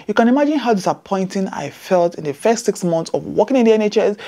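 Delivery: usual speaking voice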